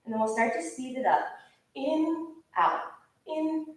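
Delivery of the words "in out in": high, evenly spaced in-and-out calls; pitched-up "in", dropping on "out"; rhythmic breath cues. Real, not acted. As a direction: count the breaths in a steady, lilting rhythm.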